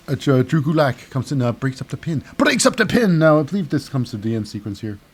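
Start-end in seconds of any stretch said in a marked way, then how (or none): 2.4-3.2 excited call. Acted.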